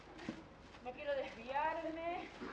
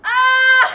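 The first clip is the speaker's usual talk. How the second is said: a sudden piercing scream breaking into a howling laugh; startled scream then laughing shout; 4 semitones higher, 19 dB louder than usual. Acted. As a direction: scream as the vision hits, then cackle. Psychic shriek and laugh.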